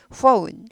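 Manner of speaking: "phone" said slowly with a full "ou" glide; oh-oo vowel. Shown as a demonstration. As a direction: stretch "phone" into "foun". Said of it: "우까지 꼭 발음을 해주세요".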